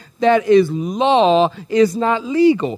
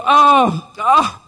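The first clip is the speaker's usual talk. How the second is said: mock delight; 3 semitones higher, much slower than usual. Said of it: "you go".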